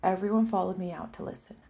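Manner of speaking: usual speaking voice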